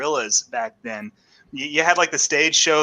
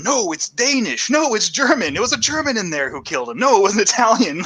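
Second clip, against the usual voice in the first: high, mock-argumentative back and forth; insistent bickering; 7 semitones higher than usual. Tenants arguing over the killer's language, insistent. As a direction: contradict each other emphatically, pitched up.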